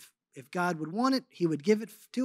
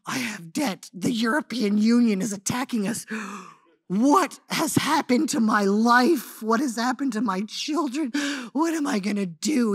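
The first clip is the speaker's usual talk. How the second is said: panicked inner cry; 4 semitones higher, 6 dB louder than usual. Retold or imitated. Overwhelmed mother retelling her panic over debt, anxious outcry. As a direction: voice climbing and louder with dread. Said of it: "And I'm like".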